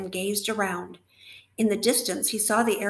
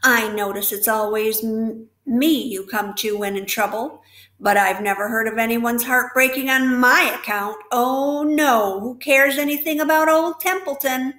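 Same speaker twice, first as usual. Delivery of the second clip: mock self-pity, louder; the rat sneers at the pig; sarcastic; 2 semitones higher, 7 dB louder than usual.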